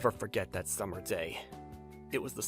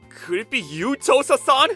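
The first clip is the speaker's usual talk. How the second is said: rising to a loud, high question; dawning shock; 9 semitones higher, 9 dB louder than usual. Acted. Designer realizing the woman is his boss, dawning shock. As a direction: build from hesitant to stunned.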